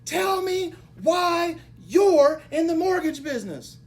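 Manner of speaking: loud, high and slow, each word hammered; slow demanding shout. Retold or imitated. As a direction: demand an answer like a harsh mentor. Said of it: "And then he says".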